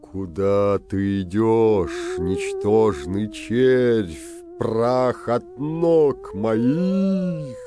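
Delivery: slow booming ghost voice; loud, slow menacing phantom; disguised deep voice. A narrator's reading. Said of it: "сказал страшным голосом средний призрак".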